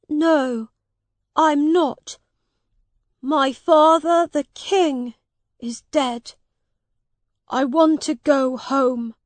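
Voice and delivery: lightly voiced high, upset young prince; a boy close to tears for his father; grieving boy king. A narrator's reading. Edward tells Miles his father is dead, upset.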